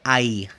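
"ai" as one gliding sound, like "eye"; diptongo ai. Shown as a demonstration. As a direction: glide from a to i on "ai". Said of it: "Primero el diptongo". ("ai" como diptongo, "ái")

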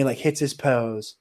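ordinary talk, nothing marked

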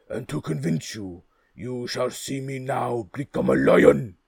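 loud, brisk ogre boast; brisk booming ogre. Acted. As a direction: boom it out like a proud ogre. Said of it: "answered the ogre very briskly".

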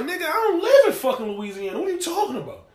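high-pitched mock indignation; incredulous denial. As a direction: act out a baffled man protesting. Acted father denying the warrant.

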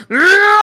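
loud, high-pitched battle roar; explosive roar of a charging fighter. Acted. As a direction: roar it out as a barbarian rushing in. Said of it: "So Kroger goes".

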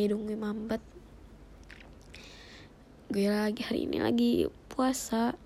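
usual speaking voice

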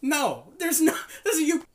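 high, loud, laughing no; loud laughing rejection. Acted. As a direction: reject it loudly while cracking up. Shark rejecting the pitch.

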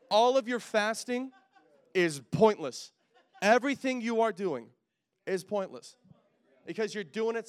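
loud, slow, punching each phrase; full-force preaching emphasis; emphatic preaching. Real, not acted. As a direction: hit pointless hard like a verdict. Preacher hammering the point home.

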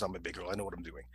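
low, soft brush-off; cool self-assurance. Retold or imitated. Woman answering a friend's warning, low and self-assured. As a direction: quietly dismiss the concern.

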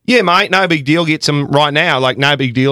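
imitating a carefree Australian tour manager, Australian accent. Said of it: "your accent is perfect".

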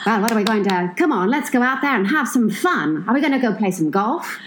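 put-on glamorous old Hollywood star in Mid-Atlantic accent; Mid-Atlantic accent, Katharine Hepburn style. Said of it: "Can, can we talk in the Mid-Atlantic?".

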